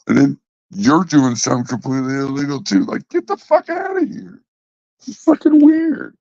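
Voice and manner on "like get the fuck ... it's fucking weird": high-pitched incredulous scoff; mocking scoff. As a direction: squeal it in mock disbelief. Mocking scoff at trash talkers.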